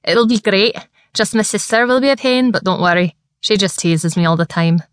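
Scottish lad, excited and chatty; broad Scottish accent. Acted. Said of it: "Argyle nodded excitedly".

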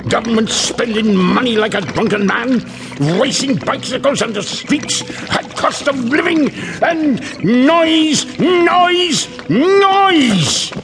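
high rant building to drawn-out yells; exasperated rant. Acted. Janitor railing at the modern world, exasperated.

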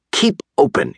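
high but restrained yell; yelled order. A narrator's reading. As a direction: call the order out high, without real force. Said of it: "yelled Doctor DeSoto".

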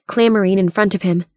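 plain reading voice

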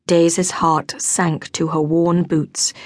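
ordinary delivery, nothing marked

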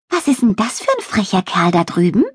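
fast, piping little spider voice; offended squeak. A narrator's reading. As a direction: squeak it out quickly, in a huff. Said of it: "piepste sie beleidigt". (beleidigt piepsendes Stimmchen)

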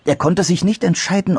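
usual speaking voice

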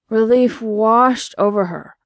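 ordinary delivery

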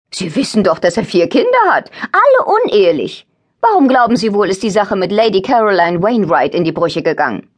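high, excited gossip, hammed up a little; gossiping aunt voice. A narrator's reading. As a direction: dish the scandal with glee. Narrator voices gossiping Tante Eddie.

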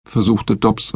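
ordinary delivery

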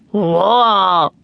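drawn-out gorilla grunt, falling; young gorilla call. A narrator's reading. Youngster scooped up in the keeper's net, gorilla grunt. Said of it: "said the youngster".

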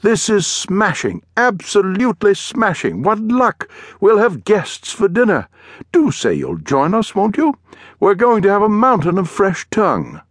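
high-pitched, delighted English gentleman; English accent. A narrator's reading. Suited Englishman inviting strangers to dinner, in a high English accent. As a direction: gush in a plummy English accent, pitched well up. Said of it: "spoke in a strange accent".

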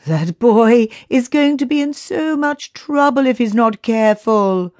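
high, amused chuckling voice. A narrator's reading. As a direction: say it through amusement. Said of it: "laughed Somat".

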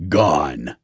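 low growling boss voice; deep growl. A narrator's reading. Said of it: "his deep voice growling".

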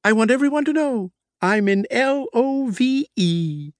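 high spelled-out love declaration; lovestruck proclamation. Acted. Lovestruck boss declares love to his secretary, high and giddy. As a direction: sing out the letters, giddy.

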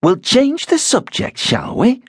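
high, tight-lipped and prim; change the subject primly; prim wife. A narrator's reading. Wife shutting down the row, high and prim. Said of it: "Rosa pursed her lips".

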